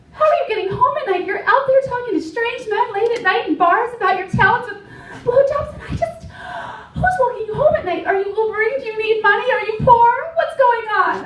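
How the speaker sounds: high, fast, loud anxious mother; worried mom. Retold or imitated. Comic imitating her mother fretting about her safety. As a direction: rattle off worries like a panicking mom. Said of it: "And she's like".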